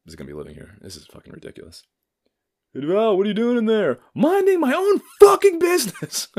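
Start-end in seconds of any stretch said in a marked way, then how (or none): 2.7-4.0 imitating father calling out. 4.1-6.3 mock snappy shout. His snappy reply.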